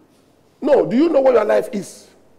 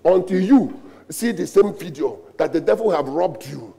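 usual speaking voice

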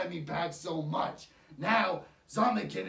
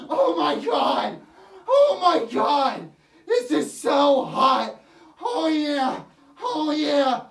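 hammed-up ecstatic shrieks, much higher, louder and slow; mock orgasm; 8 semitones higher, 11 dB louder, much slower than usual. Mocking fans who gush over the movie, hammed-up ecstatic shrieks. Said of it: "having orgasms".

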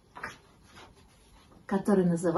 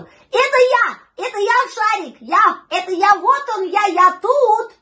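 high, loud eager yelps; frantic high-pitched puppy calls; yapping puppy; 12 semitones higher, 8 dB louder than usual. A narrator's reading. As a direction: yell for attention like a barking pup. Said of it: "мчался за ними и лаял".

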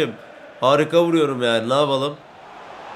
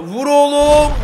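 high loud cry to shoot; excited shout urging a shot; 9 semitones higher, 6 dB louder, slower than usual. Real, not acted. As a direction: yell at him to shoot.

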